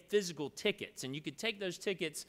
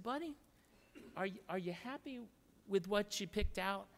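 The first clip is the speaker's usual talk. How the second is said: tender dad; 5 semitones higher, 4 dB quieter than usual. Retold or imitated. Dad gently asks his son.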